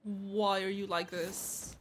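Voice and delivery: ordinary talk, nothing marked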